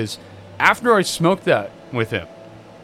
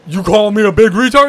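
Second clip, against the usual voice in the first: offended brother; 5 semitones higher, 7 dB louder than usual. Retold or imitated. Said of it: "He goes".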